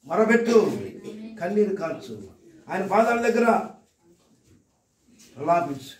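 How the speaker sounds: rising, ringing calls of praise the crowd answers; fiery preaching. Acted. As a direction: preach it at full force, building each line.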